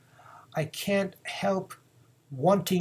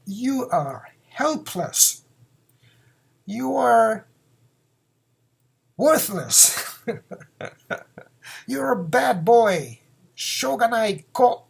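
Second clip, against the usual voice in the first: scolding; 4 semitones higher than usual. Acted. Parent scolding a hopeless child, lightly acted. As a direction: tell the kid off. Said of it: "You are a しょうがない child".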